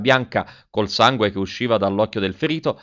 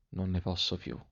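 much softer, dying away, falling tune; fading despair; 3 semitones lower, 13 dB quieter than usual. A narrator's reading. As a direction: let it fade almost to nothing.

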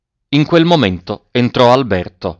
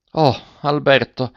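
a hollow, higher cry of his name; muffled exclamation; 4 semitones higher than usual. A narrator's reading. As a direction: call out to him, heartbroken and muffled. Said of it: "esclamò la derelitta con voce sorda".

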